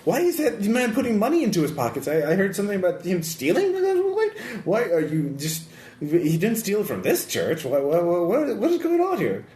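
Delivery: high-pitched, bewildered priest voice; confused priest. Acted. Game master as priest walking into interrogation, confused. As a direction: voice a priest utterly baffled by the scene. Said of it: "He is really confused".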